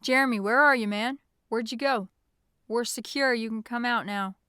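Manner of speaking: loud holler into the night; shouted call. A narrator's reading. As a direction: call out to someone far off. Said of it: "Nathan's voice screamed out into the night".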